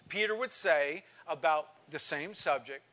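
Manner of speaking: usual speaking voice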